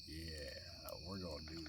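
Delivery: low, hushed plotting voice; conspiratorial. Retold or imitated. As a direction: murmur it low like a secret scheme. Parents secretly plot with a wink.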